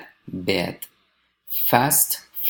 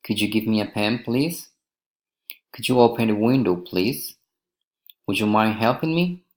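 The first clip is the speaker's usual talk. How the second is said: polite requests climbing on "please" and "me"; rising request intonation. Shown as a demonstration. Teacher models polite requests, rising on "please" and "me". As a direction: lift the pitch at "please" and on "helping me". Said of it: "rising intonation".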